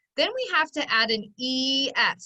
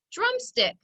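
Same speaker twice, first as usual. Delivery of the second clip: DRUMSTICK said quickly, much higher at the start; quick whole word; 12 semitones higher than usual. Shown as a demonstration. Teacher repeating the blended word, DRUMSTICK quick and whole. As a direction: say "Drumstick" as one brisk, high-pitched word. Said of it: "Put it together".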